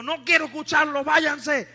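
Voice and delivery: loud, high, quick angry refusal, acted out; aggressive. Atheist woman sending evangelists away, imitated aggressively. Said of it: "Se puso agresiva".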